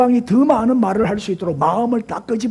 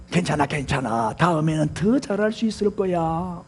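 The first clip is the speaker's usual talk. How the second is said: low, gentle comforting; soft reassurance; 4 semitones lower, 5 dB quieter than usual. Shown as a demonstration. Parent encouraging a child after failure, soft reassurance. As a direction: soothe softly, stretching the endings. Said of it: "부드러운 말은".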